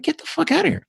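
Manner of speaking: squeaky high stunned disbelief; incredulous disbelief. Retold or imitated. Telling his brother he can't believe it, high incredulous. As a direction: pitch shoots way up in shock. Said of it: "And I'm like".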